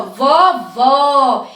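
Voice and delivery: vovó with open final ó, like "vo-VÓ" with an open "ó". Shown as a demonstration. Teacher showing the open ó in vovó to children. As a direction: stress and open the last ó of vovó. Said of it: "Presta atenção o nome vovó".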